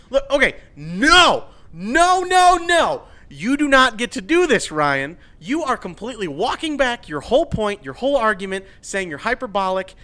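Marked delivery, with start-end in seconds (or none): 0.1-7.8 loud, high, exasperated no no no; angry outburst. Real, not acted. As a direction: shout him down in disbelief. Ranting at the tweeting writer.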